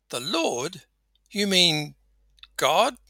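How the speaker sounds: high, incredulous questioning; halting disbelief, rising on the last word; astonished. Acted. Man on cliff learns who is speaking, astonished.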